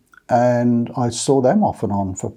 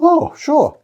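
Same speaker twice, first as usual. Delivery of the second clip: much higher, louder chirpy yes; cheery reply; 5 dB louder than usual. Retold or imitated. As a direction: squeak out an eager agreement. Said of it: "And I went".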